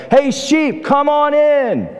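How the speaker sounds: high, drawn-out holler without real volume; mock yell. Young pastor hollers to sheep far off in the pasture, mock yell. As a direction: stretch it out as if calling across a field. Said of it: "So he yells it this time".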